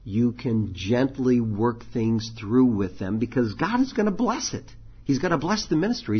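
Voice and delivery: ordinary talk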